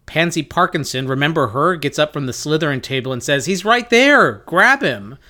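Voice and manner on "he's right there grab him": high, raised cry of alarm; acted accusing shout. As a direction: rouse the room to seize someone. Pansy accuses Harry before the hall.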